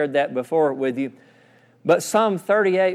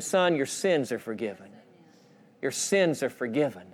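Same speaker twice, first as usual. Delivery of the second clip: soft, lowered fatherly assurance; tender reassurance; 4 semitones lower, 8 dB quieter than usual. Acted. Preacher voicing Jesus to the paralyzed man, tender reassurance. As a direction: say it softly, letting each line settle.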